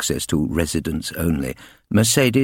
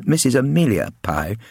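ordinary delivery

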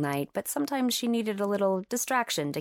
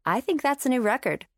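higher-pitched, teasingly impressed; mock enthusiasm; 7 semitones higher than usual. A narrator's reading. Lily teases Cam about his short date with mock enthusiasm. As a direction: pretend to be impressed by the feat. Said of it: "said with mock enthusiasm".

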